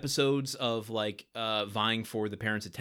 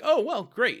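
high, bright mock enthusiasm; chirpy distracted dad; 12 semitones higher, 5 dB louder, slower than usual. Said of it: "he's like".

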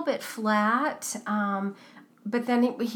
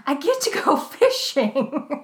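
a mock excited kid's shout, louder, higher, laughing; 6 semitones higher, 6 dB louder than usual. Host imitating Reed's excited shout, laughing. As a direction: cheer it out like a thrilled kid. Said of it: "And Reed shouts".

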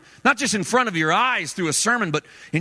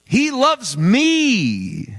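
awed new believer; 3 semitones higher, much slower than usual. Acted. Preacher voicing a new convert's discovery, long-drawn and amazed. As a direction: stretch each word out, lifting into it. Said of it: "you said".

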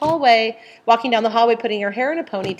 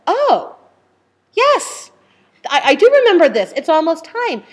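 high, loud "oh, yes"; light up with recognition, pitched up; retold sudden realization; 7 semitones higher, 6 dB louder than usual. Laboring mother remembering it's almost time, excited realization.

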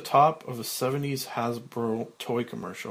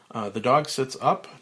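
usual speaking voice

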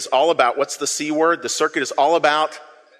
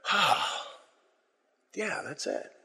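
soft relieved recognition; 11 dB quieter, slower than usual. Retold or imitated. Body reacting to truth.

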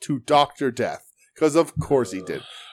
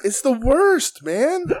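exasperated; 10 semitones higher, much slower than usual. Real, not acted. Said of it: "exasperated rant".

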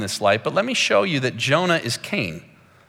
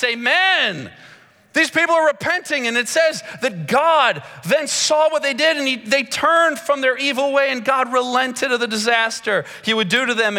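much higher, building joy over the repentance; excited preaching; 9 semitones higher than usual. Acted. Preacher telling the congregation the whole city repents, excited. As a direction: lift them up with the good news.

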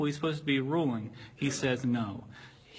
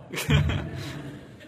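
laugh; 8 semitones higher than usual. Real, not acted. Laughing at own joke.

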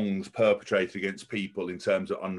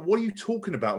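incredulous; 10 semitones higher than usual. Retold or imitated. Retold incredulous reply.

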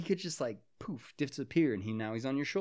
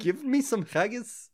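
slow, higher mock Scottish; Irish-Scottish accent attempt; 8 semitones higher, slower than usual. Friend ordering haggis for laughs, bad Scottish accent. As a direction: drag it out in a muddled Irish-Scottish brogue. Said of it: "I think that was Scottish".

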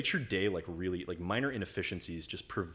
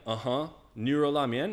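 higher, louder, questioning rise; clueless learner repeating; 6 semitones higher, 7 dB louder than usual. Retold or imitated. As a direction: echo the foreign dish name as a tentative question. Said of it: "And I'm like".

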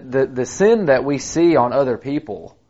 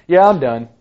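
higher, louder tossed-off quote; mock quick surrender; 5 semitones higher, 6 dB louder than usual. Demon gives up the moment Jesus shows up, mock surrender. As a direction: give up instantly, for comic contrast. Acting the demon giving up at once.

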